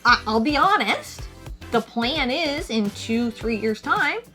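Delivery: usual speaking voice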